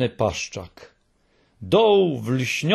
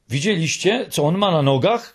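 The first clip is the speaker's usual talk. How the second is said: eager calling out, a bit higher; mildly raised, excited question; 4 semitones higher than usual. A narrator's reading. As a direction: blurt it out all worked up. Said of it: "krzyczała".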